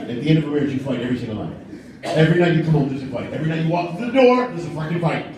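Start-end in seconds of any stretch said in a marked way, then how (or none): none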